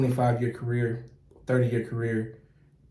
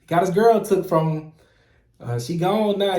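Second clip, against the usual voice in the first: imitating teasing coworkers, pitched up; clowning; 5 semitones higher, 4 dB louder than usual. Coworkers joking about his breakup, clowning him. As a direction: poke fun like the crew piling on, higher voice. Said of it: "they was clowning me".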